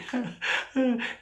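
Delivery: high chuckle at own joke; real high-pitched giggle; laugh. Real, not acted. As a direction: laugh at your own silly pun. Laughs at his own punchline.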